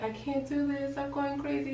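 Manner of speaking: high, thin mock whimper; imitated crying. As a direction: whimper it as if in tears. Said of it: "I started crying. I was like".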